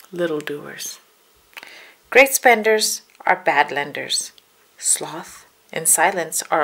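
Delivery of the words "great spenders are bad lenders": plain reading voice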